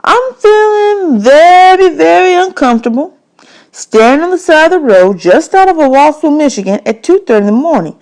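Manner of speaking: uneasy stranger on a dark roadside; the nervous man. Acted. Man's voice in audiobook.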